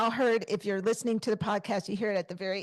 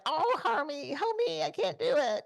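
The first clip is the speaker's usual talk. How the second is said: Marge Simpson voice; 7 semitones higher than usual. Retold or imitated. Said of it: "I sounded like Marge Simpson when I would go hoarse".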